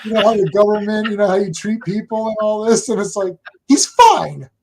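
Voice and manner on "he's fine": retold exasperated protest. Acted indignant discovery E.T. lived.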